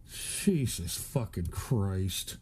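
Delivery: low, soft, slow, weary disbelief; exasperated groan. Real, not acted. Streamer fed up with the donations, groaning low and slow. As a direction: drag it out like a long-suffering sigh.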